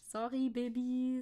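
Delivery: usual speaking voice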